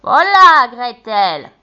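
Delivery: loud impatient witch shout. A narrator's reading. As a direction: yell for Gretel. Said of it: "crie-t-elle".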